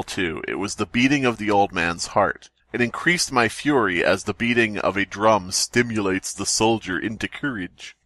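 normal talking voice

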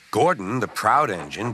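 ordinary delivery, nothing marked

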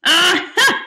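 loud, high exclamation; triumphant cry. A narrator's reading. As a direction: burst out as the hero spots an opening. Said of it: "cried Mungo".

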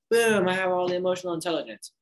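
half-joking swagger, hammed up; mock boastful. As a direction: puff yourself up like a know-it-all. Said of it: "and be like".

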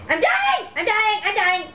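high panicked cries; panic, drowning in game. Real, not acted.

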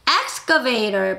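excavator drawn out very slowly, each syllable clear; teacher modelling a word very slowly; slow model word. Shown as a demonstration. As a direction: stretch out excavator for learners to copy. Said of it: "시작".

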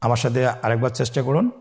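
ordinary talk, nothing marked

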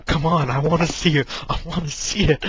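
high, loud pleading; impatient friend begging to see; eager begging. Retold or imitated. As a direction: beg to see it, excited. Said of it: "Liz just sitting there going".

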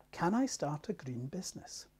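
hushed whisper for the letter. Acted. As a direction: whisper the question. Reading out a listener's letter.

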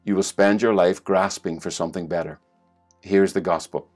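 ordinary delivery

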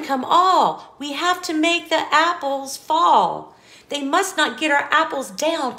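put-on bouncy storybook reading for a child; lively read-aloud. Parent reading an apple-stacking book to a child, sing-song. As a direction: read it like a bedtime picture book, rhythmic and bright.

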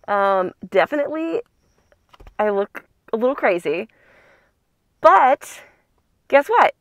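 slightly muffled by plastic mask; speaking behind clear shield; through a face mask. Real, not acted. Seen 0: Clear plastic mask covers her mouth throughout labelled speech.

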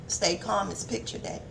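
near-whispered reminder to herself; soft murmured aside; hushed aside. Real, not acted. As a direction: drop to a hush mid-sermon.

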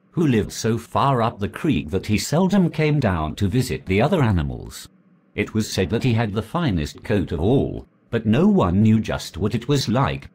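usual speaking voice

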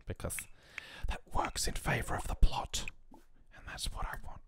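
whispered plotting aside; scheming whisper. Acted. The player confiding a plan to wreck the Sims' marriage, whispered. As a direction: let the audience in on the evil plan, whispered.

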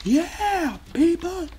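high, whooping celebration; excited cheering. Real, not acted. Player celebrating an achievement, cheering high. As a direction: cheer to the whole group in a high voice.

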